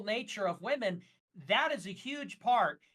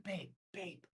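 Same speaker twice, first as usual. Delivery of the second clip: mock-meek, much softer and lower; small, halting, submissive imitation; meek mimicry; 3 semitones lower, 8 dB quieter than usual. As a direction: imitate a man feebly protesting under his breath. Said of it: "He just kinda took it".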